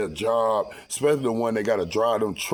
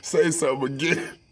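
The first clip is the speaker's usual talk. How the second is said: retold challenge; 5 semitones higher than usual. Acted challenge in quarrel.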